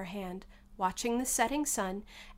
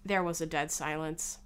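ordinary delivery, nothing marked

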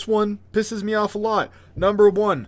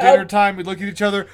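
normal talking voice

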